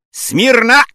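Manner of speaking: high, shouted officer's order; sudden parade-ground shout; loud command. Acted. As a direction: call the room sharply to attention. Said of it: "zawołał głośno".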